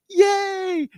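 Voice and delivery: a fan squealing with joy, mimicked; imitating cheering fan. Imitating a thrilled fan in the crowd, high mock cheer.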